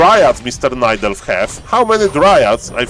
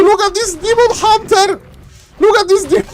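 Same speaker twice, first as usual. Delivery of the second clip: much higher, urgent hype; excited caster. Real, not acted. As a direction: point at the demon hunter excitedly. Hyped play-by-play.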